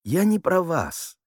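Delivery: high, slow, placating voice; soothing high-pitched clarification; explaining. A narrator's reading. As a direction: explain it innocently, drawing it out. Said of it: "объяснил Гурин".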